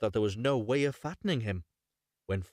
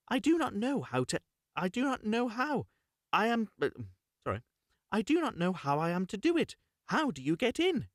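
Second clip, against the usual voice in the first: put-on much higher little-girl voice; Gretel playing dumb; 10 semitones higher than usual. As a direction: feign not knowing how. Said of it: "and said".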